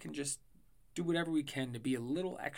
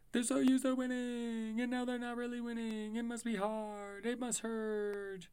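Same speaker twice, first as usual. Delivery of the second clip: mock sympathy; 9 semitones higher than usual. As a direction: fake sorrowful tone.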